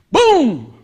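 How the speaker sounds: loud high mock boom; big dramatic boom; booming sound effect. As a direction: slam it out like a thunderclap. Jesus stills the storm.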